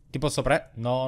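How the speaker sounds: normal talking voice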